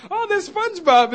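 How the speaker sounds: high, loud excited call; excited wife. Retold or imitated. Wife spotting SpongeBob from the office, high excited call. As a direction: point out the window all thrilled. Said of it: "she's, like, in my office talking about".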